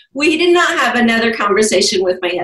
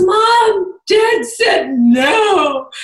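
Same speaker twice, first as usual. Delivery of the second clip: higher, slow wailing mock sob; imitating a crying daughter; 9 semitones higher, much slower than usual. Daughter crying to mom after dad's refusal, mock wail. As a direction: whine it like a tearful girl. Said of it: "and she's crying".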